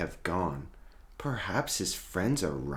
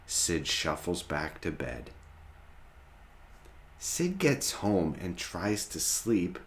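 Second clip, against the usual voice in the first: plain reading voice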